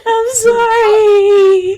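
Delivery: slow, loud wailing apology; wailing sorry. Acted. Seren keeps wailing her apology to Alara. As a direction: stretch it out in a sob. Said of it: "She begins to cry".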